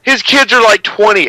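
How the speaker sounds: high, loud disbelief; incredulous protest. Real, not acted. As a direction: protest it, voice jumping up. Indignant correction of cohost.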